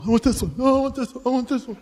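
lightly voiced frantic boy; greedy kid. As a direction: beg for every toy on the wall. Son in shopper's trauma.

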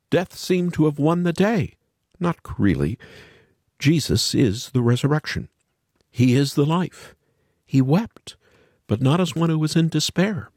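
usual speaking voice

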